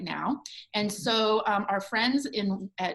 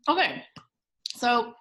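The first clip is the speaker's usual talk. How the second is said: ordinary talk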